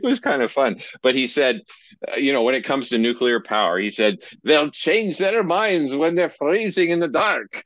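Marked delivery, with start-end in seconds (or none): 4.4-7.6 put-on a light Scottish accent, slow and a bit higher; lightly put-on Scottish accent for the old professor; Scottish accent impression. Quoting his Scottish professor on nuclear power, light Scottish accent. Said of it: "I can't do a Scottish accent for this one".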